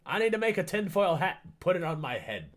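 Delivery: excited. Real, not acted. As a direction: blurt it out, thrilled. Excited about the tinfoil hat.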